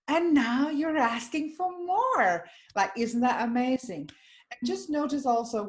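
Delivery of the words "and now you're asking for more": higher, slower, with a lift of delight; bright, uplifting reframe. Retold or imitated. Reframing the woman's words for her, higher and uplifting. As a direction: hand the line back as good news. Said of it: "And I said".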